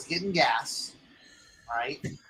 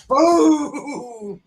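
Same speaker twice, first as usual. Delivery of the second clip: loud, high imitated boom; crash noise; 8 semitones higher, 7 dB louder than usual. Motorcycle slamming into the median, imitated boom. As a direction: throw out the crash like a sound effect. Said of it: "and goes".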